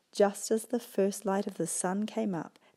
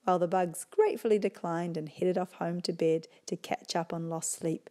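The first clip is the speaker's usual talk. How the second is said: plain reading voice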